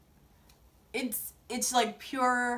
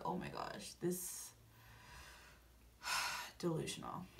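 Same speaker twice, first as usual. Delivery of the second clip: whispered disbelief with a sigh; hushed, sighing dismay; exasperated aside; 6 semitones lower, 16 dB quieter than usual. Retold or imitated. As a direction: breathe it out, giving up. Her exasperated aside on Rachel.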